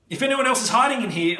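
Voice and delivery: loud, high call into the ship; shout. A narrator's reading. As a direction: shout a warning into the wreck. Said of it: "I shout".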